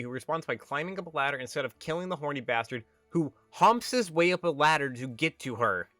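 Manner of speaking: ordinary talk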